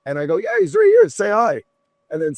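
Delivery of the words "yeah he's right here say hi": bright, much higher excited squeal; excited phone greeting. Retold or imitated. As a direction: thrust the phone forward, thrilled. Showing the FaceTime call around.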